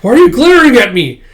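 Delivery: high, loud challenge; mock indignant. Host to a glaring guest, mock indignation. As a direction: demand an answer, hammed-up indignation.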